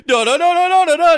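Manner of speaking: very high, loud, frantic mock scream; mock panic. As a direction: shriek it like someone losing it. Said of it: "It was like".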